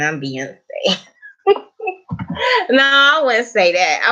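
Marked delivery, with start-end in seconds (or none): 1.4-2.6 high-pitched amused laugh. Real, not acted. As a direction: burst out laughing at your own boast. Laughing at her own [name] comparison.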